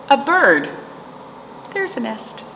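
ordinary talk, nothing marked

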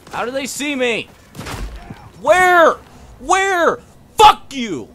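high, loud panicked shouting; raging gamer under fire; furious yelling. Real, not acted. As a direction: scream at unseen enemies. Being shot, yelling.

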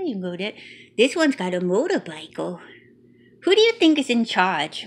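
ordinary delivery

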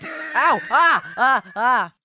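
loud yelps of pain. Real, not acted. Player getting hit, loud pained yelps. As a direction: yelp at each hit.

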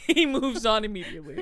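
amused repeat through real laughter; real laugh breaking into the words. Real, not acted. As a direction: say it while cracking up. Repeats the line while laughing.

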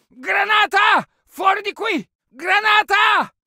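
alarmed battlefield yelling; urgent warning shout. Acted. Soldier warns squad of grenade, urgent shouting. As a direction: warn everyone before it blows.

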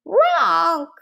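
high, drawn-out honk; squawking baby dragon. A narrator's reading. Dragonling struggles to get away, squawking. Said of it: "it squawked".

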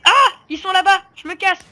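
high, loud scared fleeing; panicked mock voice. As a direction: yelp it like a frightened player. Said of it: "Le mec". (voix paniquée imitée, aiguë et forte)

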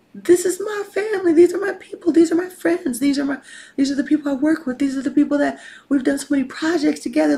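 high, loud, insistent listing; impassioned voice of someone let down; acted betrayed protest. Voicing a betrayed person's disbelief.

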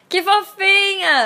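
high, slow coo of delight; gushing delight. Real, not acted. YouTuber cooing over a sweet fan on the phone, high and drawn out. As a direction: melt over how cute the fan is. Reacting after a fan's sweet call.

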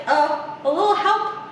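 lightly voiced helpless character; stuck Not. A narrator's reading. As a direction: call for a little help, pitch climbing at the end. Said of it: "Not's like".

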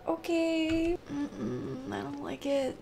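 small, soft, uneasy voice; hushed nervous refusal; timid reluctance. Real, not acted. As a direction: shrink back quietly from what's ahead. Scared to go further in game.

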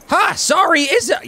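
high-pitched pretend yell; mock yelling. Player imitating the noisy Spark, mock yelling. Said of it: "he's yelling".

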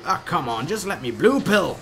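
loud frustrated plea; frustrated yell. Real, not acted. Frustrated at game.

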